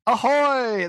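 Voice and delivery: high booming ahoy; loud greeting. Real, not acted. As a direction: shout the greeting. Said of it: "That's what I".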